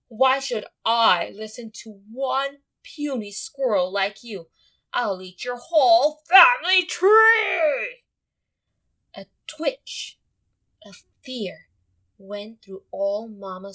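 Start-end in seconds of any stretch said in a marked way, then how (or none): 0.1-8.0 slow, loud bear threat, building to a roar; menacing bear. Acted. Bear threatening to eat the squirrel's whole family. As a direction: loom over the little squirrel.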